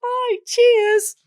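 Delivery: put-on very high, strained Newcastle accent; squeezed-out Geordie. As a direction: squeak it out in mock Geordie. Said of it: "I squeezed out".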